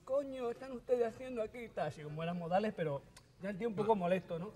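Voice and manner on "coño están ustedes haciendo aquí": high prim voice getting cross; polite anger from the guru; annoyed guru. Retold or imitated. Guru owner scolds the guests, high prim irritation. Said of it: "te mosqueas".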